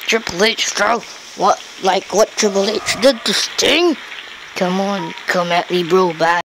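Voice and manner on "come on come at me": normal talking voice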